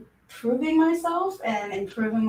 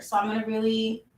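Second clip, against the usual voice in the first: ordinary delivery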